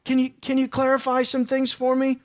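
Peter pleading gently. Retold or imitated. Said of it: "what was going on through his mind".

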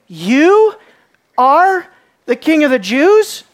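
mock him with the question, scoffing; mocking statement. Pilate scoffing at the accused prisoner, mocking. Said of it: "the question is actually a mocking statement".

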